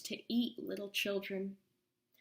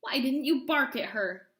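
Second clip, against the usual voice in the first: angry shout; 3 semitones higher, 5 dB louder than usual. Acted. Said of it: "shouted Bony Legs".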